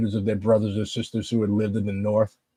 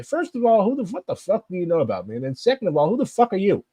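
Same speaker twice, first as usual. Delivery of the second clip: high, heated cursing comeback; much higher, offended who the fuck; indignant outburst; 8 semitones higher than usual. Real, not acted. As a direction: fire back at an insult, indignant. Answering an insulting message.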